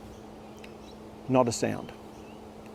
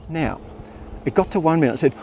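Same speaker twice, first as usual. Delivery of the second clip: usual speaking voice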